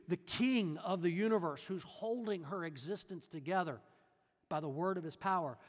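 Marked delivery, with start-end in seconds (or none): none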